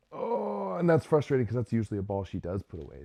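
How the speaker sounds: a sudden high "oh" then fast and loud; fast, loud, exasperated commentary; frustrated outburst. Real, not acted. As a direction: blurt out the frustration at the missed ball. Groaning at a missed kill.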